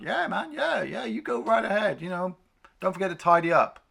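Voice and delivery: high, laid-back chef voice. Retold or imitated.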